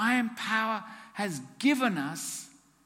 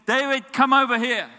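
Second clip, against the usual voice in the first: commanding summons; 6 semitones higher, 9 dB louder than usual. Retold or imitated. God summoning.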